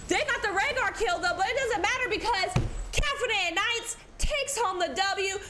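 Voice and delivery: rising, laughing hype; excited winning call. Real, not acted. Caster announcing the winners, excited. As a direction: crown the champions.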